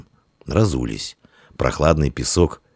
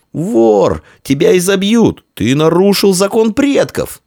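high, heated shouting; angry accusation; 5 dB louder than usual. Acted. Boy to thief who broke ancestral law, shouting angrily. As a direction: threaten the thief in a raised, high voice.

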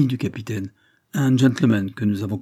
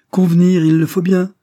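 indignant retort; 8 semitones higher, 9 dB louder than usual. Acted. Sophie snaps back at Ravensbird.

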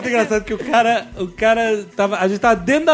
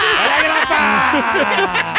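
slow, laughing call-out; 4 semitones higher, much slower than usual. Pretend. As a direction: cheer it out like a toast. Mock toast, shouting out.

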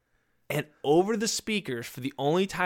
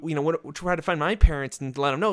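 ordinary delivery

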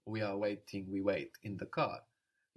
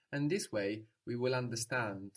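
normal talking voice

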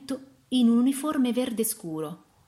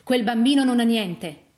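loud, raised, emphatic statement; loud protest, higher in pitch; firm declaration; 4 semitones higher, 8 dB louder than usual. A narrator's reading. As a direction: declare it forcefully. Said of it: "dichiarò".

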